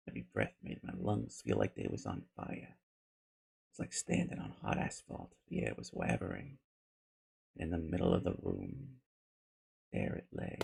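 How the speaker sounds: low, hushed storytelling; grim recollection. Acted. Survivor recounts the incinerator, hushed and grim. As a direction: tell it softly, sinking low.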